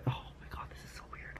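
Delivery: hushed aside under the breath; whispering. Real, not acted. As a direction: whisper it to yourself.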